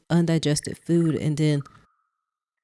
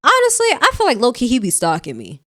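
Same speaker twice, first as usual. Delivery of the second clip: excited; 6 semitones higher, 9 dB louder than usual. Real, not acted. Player jokes excitedly.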